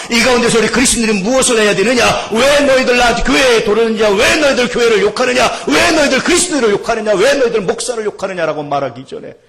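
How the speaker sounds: loud, high, driving rhetorical questions; full-force preaching. Real, not acted. Preacher challenging Christians on how to live, impassioned shouting. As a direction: hammer out each challenge to the congregation.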